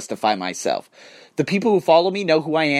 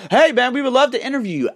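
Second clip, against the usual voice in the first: high, louder mock-friendly pitch; much higher, upbeat courtesy voice; eager interviewer; 10 semitones higher, 4 dB louder than usual. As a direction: play a keen reporter asking nicely. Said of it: "comes to me and says".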